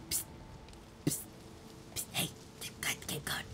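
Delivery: voiceless secretive whisper; urgent whisper. Pretend. Player nudging game companion onward, whisper.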